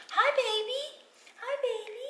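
high, sing-song pretend mommy. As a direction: coo at the baby in a high voice. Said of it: "Okay, I'll be the mommy".

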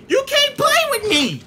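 very high, loud boast; gloating shout. Real, not acted. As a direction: yell it high and drawn out in triumph. Gloating after a kill.

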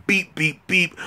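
high-pitched treadmill beeps; imitated machine beeps. Man mimicking the treadmill buttons he presses, imitated beeps.

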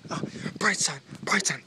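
loud, high-pitched cheer. Pretend. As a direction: call it out brightly.

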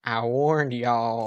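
exaggerated southern drawl, slow; fake southern accent. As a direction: drag out the southern vowels.

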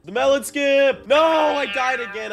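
high, very loud gamer yell; all-out shout. Real, not acted. Player attempting the melon skip, all-out shout. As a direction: bellow it high through the failed stunt.

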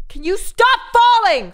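loud, high, slow yell. Real, not acted. Streamer yells at the game character to stop falling, loud high yell. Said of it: "Sorry for yelling in your ear".

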